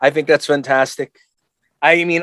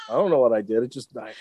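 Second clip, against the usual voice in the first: low, soft guilty-dog voice; baffled dog; 6 semitones lower, 7 dB quieter, faster than usual. Acted. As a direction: trail off like a sheepish dog. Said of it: "The dog looks at you like".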